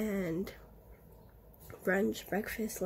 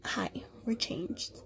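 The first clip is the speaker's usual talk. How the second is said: whispering; 3 dB quieter, slower than usual. Real, not acted. Slow whisper.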